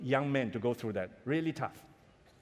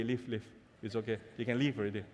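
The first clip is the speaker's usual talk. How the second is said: tender blessing; 6 semitones lower, 7 dB quieter, faster than usual. Retold or imitated. Father releasing son tenderly.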